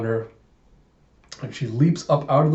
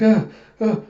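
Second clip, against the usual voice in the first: startled yelps; 9 semitones higher, 5 dB louder than usual. Acted. Startled by butterfly, opens fire.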